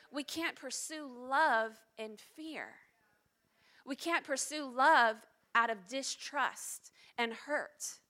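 slow, weighty sermon lines leaning on love; earnest preaching. Acted. Pastor pleading with congregation, slow and weighty. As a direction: press each line home to the congregation.

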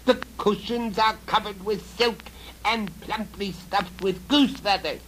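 high, loud goblin voice; high goblin coaxing; goblin man. Acted. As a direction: squeak it out like an old goblin. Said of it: "said the old goblin man".

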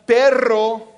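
perro said slowly with a rolled rr; trilled rr. Shown as a demonstration. Pastor contrasting perro and pero. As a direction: roll the rr in perro. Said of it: "Perro means dog".

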